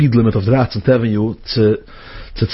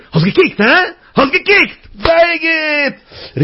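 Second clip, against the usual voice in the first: high, gleeful gotcha voice; taunting talking van; 14 semitones higher, slower than usual. Acted. The van speaking to the driver who looked, taunting. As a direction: crow it high like a catch. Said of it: "Aber der Van sucht mich so".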